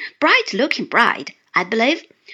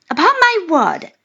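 delighted; 5 semitones higher, 6 dB louder than usual. A narrator's reading. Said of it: "greatly delighted".

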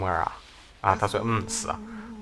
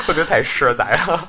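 laughing through the words; 5 semitones higher, 5 dB louder than usual. Real, not acted. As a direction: chuckle out a cheeky reply. Laughing reply to teasing.

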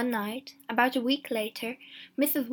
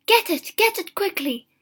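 very high, loud, frantic command; shrieked-up impatient order; urgent cry; 10 semitones higher, 9 dB louder than usual. A narrator's reading. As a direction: cry out desperately to hurry him.

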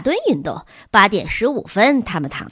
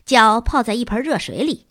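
plain reading voice

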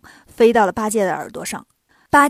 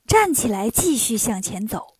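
usual speaking voice

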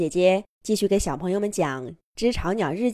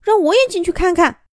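impatient little bird; 11 semitones higher, 6 dB louder than usual. Acted. Impatient little birds crowding nest.

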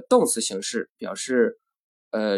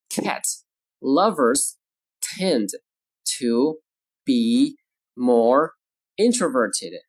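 slow, isolated words with clear pauses; word-by-word model; slower than usual. Shown as a demonstration. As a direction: dictate slowly to language learners. Teacher models sentence word by word.

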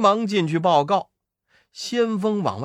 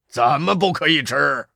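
粗声的妖王发问; 妖王不耐烦地追问; 老妖问; 3 semitones lower than usual. Acted. 老妖发问. (妖王腔调)

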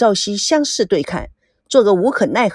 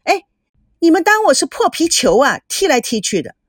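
mildly indignant outburst, pitched up at first; 恼羞成怒; 6 semitones higher than usual. A narrator's reading. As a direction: protest like you've been insulted. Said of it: "恼羞成怒地冲向前". (带点恼怒地抗议)

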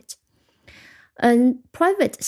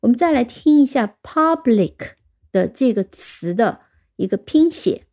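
usual speaking voice

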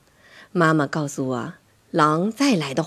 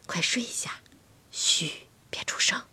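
soft bedtime whisper; whispered, barely voiced shushing; hushing mother; 9 dB quieter than usual. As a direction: hush a child to sleep. Mother hushes child in bed.

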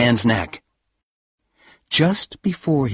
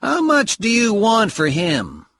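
eager buyer; 11 semitones higher than usual. Acted. Buyer asks price.

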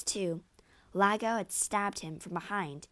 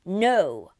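loud, angry refusal; snarled; 9 dB louder than usual. A narrator's reading. Husband refuses his wife's appeal, snarling. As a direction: snarl it with full force. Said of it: "snarled Othello".

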